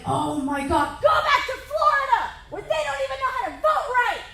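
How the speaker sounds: fast, very high hammed-up rage; furious shout. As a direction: lose it at the out-of-state driver. Raging at Florida driver.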